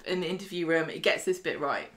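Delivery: ordinary talk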